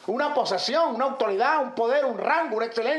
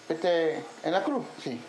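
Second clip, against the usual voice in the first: stumbling believer; 6 semitones lower, 4 dB quieter, slower than usual. Acted. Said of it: "como un teatro".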